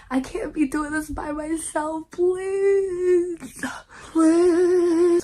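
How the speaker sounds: hammed-up tearful begging; mock crying plea. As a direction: beg like you're about to cry, hammed up. Begging for comments.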